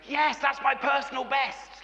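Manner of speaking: high-pitched acted burst of elation; mock-ecstatic cry of triumph; wildly excited. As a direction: imitate a runner thrilled with his result. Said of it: "he was wild with excitement".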